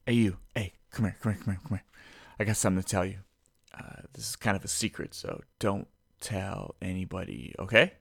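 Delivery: hushed, low confiding voice; secretive. Pretend. Podcaster confiding a secret to the listener, secretive. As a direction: beckon them in and share a secret, softly.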